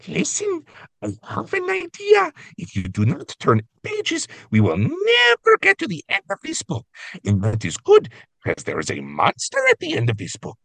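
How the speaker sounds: high, pleading monster voice; Grover voice. Retold or imitated. Co-host reading Grover's plea on her favorite page, high and pleading. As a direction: beg the reader not to turn the page. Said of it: "your Grover voice".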